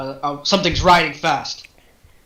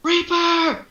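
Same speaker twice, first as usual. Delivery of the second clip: much higher, rising to a shout; panicked yell; much slower than usual. Acted. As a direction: scream the warning as you ride past. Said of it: "and he's yelling".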